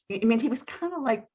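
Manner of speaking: usual speaking voice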